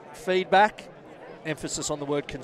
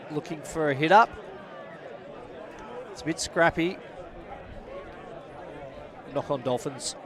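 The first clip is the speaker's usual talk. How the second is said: steady play-by-play. Acted. Live rugby match commentary.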